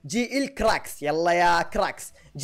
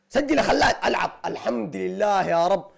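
usual speaking voice

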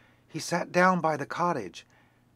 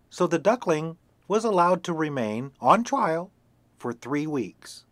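usual speaking voice

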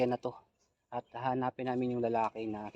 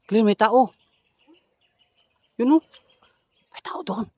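alarmed; 10 semitones higher, 10 dB louder than usual. Real, not acted. Spotting a man in the dark.

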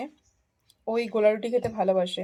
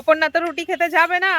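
calling out; 8 semitones higher, 10 dB louder than usual. Real, not acted. Calling out about Aparna.

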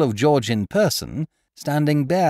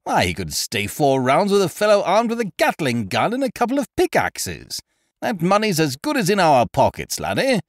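boastful bluster; 6 semitones higher, 4 dB louder than usual. Acted. Ukridge boasting after the cry.

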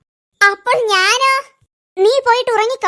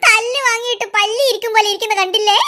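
mocking chuckle through the line; laughing at her. Boy mocks sulking aunt to his sister, laughing. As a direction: giggle at the aunt's sulking. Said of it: "ഇങ്ങനെ ചിരിക്കുകയാണോ വേണ്ടത്?".

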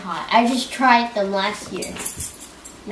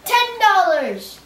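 high, loud, slow praise; excited praise; 9 semitones higher, 3 dB louder, much slower than usual. Real, not acted. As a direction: praise him proudly. Son praising dad.

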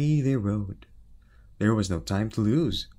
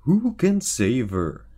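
king's voice; 4 semitones higher, 7 dB louder, slower than usual. Acted. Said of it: "asked the king".

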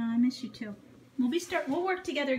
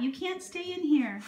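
normal talking voice

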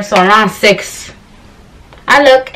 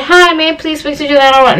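higher-pitched, courteous phone greeting; polite caller; 7 semitones higher than usual. Shown as a demonstration. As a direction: model how a polite caller should ask. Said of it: "you can't even say".

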